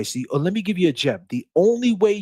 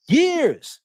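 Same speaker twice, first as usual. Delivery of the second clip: emphatic; 13 semitones higher than usual. Real, not acted. Stressing years in the rap game, emphatic. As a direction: hit it hard like proof of your career. Insisting on his long career.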